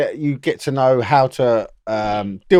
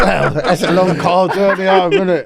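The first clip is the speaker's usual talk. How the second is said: laughing; 7 semitones higher, 6 dB louder than usual. Real, not acted. Laughing at the story.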